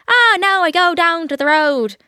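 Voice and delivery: sing-song Cork lilt, high and loud; Cork accent. Acted. Impersonating someone from Cork who sounds like they're singing, exaggerated Cork accent. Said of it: "someone speak from Cork? They're like".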